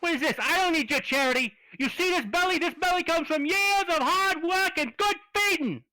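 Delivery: high, loud wiseguy raccoon picking a fight; angry tough-guy raccoon. Acted. Raccoon in a cage picks a fight with the party, loud and high. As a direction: bark at them like a caged street tough.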